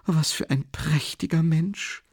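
high, slow admiration; admiring mother. A narrator's reading.

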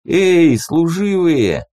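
loud, slow, tongue-tied slurring; slurred speech. Acted. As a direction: slur it like a tongue gone numb. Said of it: "заплетающимся языком произнёс он".

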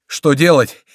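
high, loud, panicked questions; breathless shout. A narrator's reading. Monk running in with weapons clanking, panicked shout. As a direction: cry out for help, mounting. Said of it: "кричал, запыхавшись, монах".

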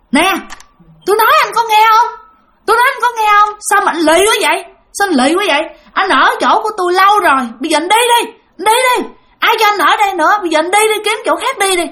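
a ghost yelling at the tenant to get out; furious scolding. Retold or imitated. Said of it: "Chửi lên".

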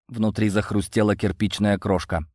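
plain reading voice

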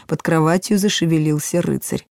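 plain reading voice

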